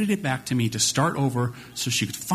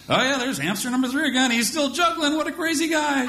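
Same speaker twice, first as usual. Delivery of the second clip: high, bright storybook enthusiasm; excited narration; 11 semitones higher than usual. Retold or imitated. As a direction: hype up the hamster for a toddler. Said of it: "so I just have to narrate".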